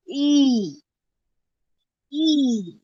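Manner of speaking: ई held long and steady, gliding down; long drawn-out vowel. Shown as a demonstration. Teacher has students repeat after her, long drawn-out ई. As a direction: draw ई out long as a single sound. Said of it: "लंबा खींचना है".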